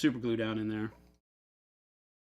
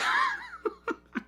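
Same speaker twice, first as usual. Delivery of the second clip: high cackle. Real, not acted. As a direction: cackle at your own joke, pitched way up. Laughing at own story.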